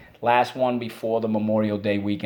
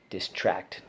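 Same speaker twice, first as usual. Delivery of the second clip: normal talking voice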